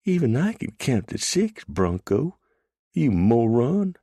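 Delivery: mock the captor with saucy confidence; saucy girl. A narrator's reading. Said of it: "said with saucy confidence".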